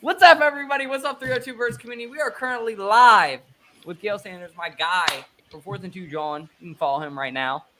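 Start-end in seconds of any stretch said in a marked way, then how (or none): 0.0-3.4 loud, high livestream welcome; excited podcast opener; hype host intro. Acted. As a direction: greet the community like a show host.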